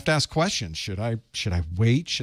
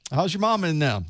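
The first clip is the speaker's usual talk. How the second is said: put-on Southern-accented greeting pitched higher; 6 semitones higher than usual. Host greeting chat the Southern way, with a Southern accent. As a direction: greet them like folks back home in the South. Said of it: "That's how, that's how we do".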